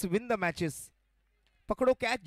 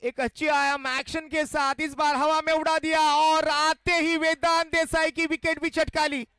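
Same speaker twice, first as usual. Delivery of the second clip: excited cricket commentary; 6 semitones higher, 4 dB louder than usual. Real, not acted. Wicket falls, live call.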